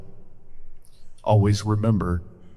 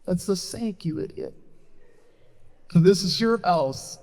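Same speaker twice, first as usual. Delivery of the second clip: much higher, exasperated scolding; exasperated friend; 8 semitones higher than usual. Acted. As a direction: scold him in a high voice. Friend scolds confused stoner.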